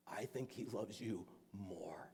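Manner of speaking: slow, intimate whisper; barely voiced, gentle reassurance; tender whisper. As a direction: whisper it slowly to comfort a child. Tender climax of lady's reassurance.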